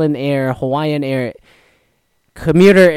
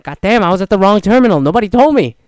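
higher, louder, fast complaint; mock frustrated outburst; 7 semitones higher, 6 dB louder, faster than usual. Tourist complaining at the gate, loud and fast. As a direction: blurt it out, annoyed and rushed. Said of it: "I was like".

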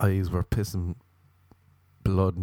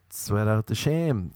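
usual speaking voice

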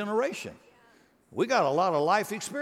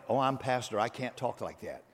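mock pious pastor; 6 semitones lower, 5 dB quieter than usual. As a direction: lower and softer, prim mock-pastor voice. Mocking his own pastor role.

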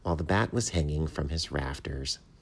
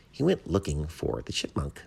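ordinary delivery

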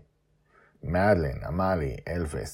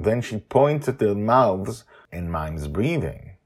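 ordinary delivery

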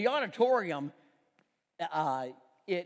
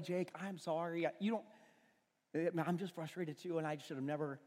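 soft apology; 3 semitones lower, 7 dB quieter than usual. Retold or imitated. Apologizing to son.

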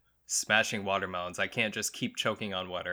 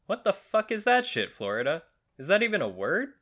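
high, louder baffled outburst; mock incredulity; 9 semitones higher, 4 dB louder than usual. Host baffled by the name Inverness, mock incredulity. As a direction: react in exaggerated disbelief, pitch jumping up.

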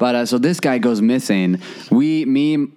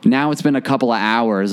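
usual speaking voice

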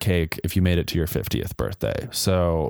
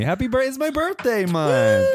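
excited; 12 semitones higher than usual. Real, not acted. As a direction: celebrate her birthday month. Celebrating her own birthday.

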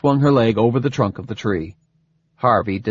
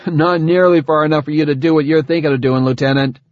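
higher, fast character voice; skeptical soldier; 5 semitones higher, 4 dB louder, faster than usual. Acted. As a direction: rattle off a wary objection to your lieutenant. Voices Harvey answering Sagan.

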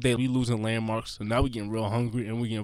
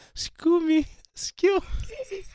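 very high, drawn-out pestering call; imitated streetwalker's call; much slower than usual. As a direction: mimic a hustler calling after tourists. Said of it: "she would only say thing, two things".

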